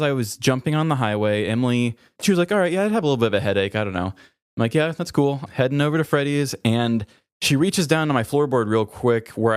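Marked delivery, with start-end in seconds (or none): none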